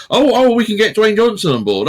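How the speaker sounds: hammed-up executive enthusiasm; mock excited brainwave. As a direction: pitch it like a sudden great idea. Acting out filmmakers' pitch meeting.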